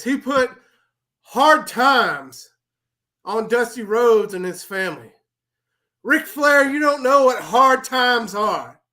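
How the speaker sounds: high, loud wrestling promo rant; Dusty Rhodes promo. Acted. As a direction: preach it like a wrestler cutting a promo. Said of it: "I'm gonna go ahead and, uh, appease the masses".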